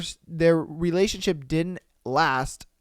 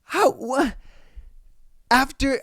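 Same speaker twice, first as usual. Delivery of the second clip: usual speaking voice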